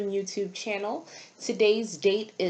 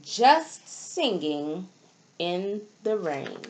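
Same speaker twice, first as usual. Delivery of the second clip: put-on reading to children. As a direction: read each line like a picture book page to a class. Reads storybook aloud to children.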